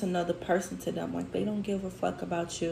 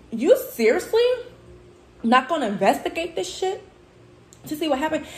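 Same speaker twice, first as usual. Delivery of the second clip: high, louder, disbelieving questioning; incredulous protest; 8 semitones higher, 6 dB louder than usual. Retold or imitated. Retelling a protest to the supervisor, incredulous. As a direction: demand an answer in high-pitched disbelief, retold.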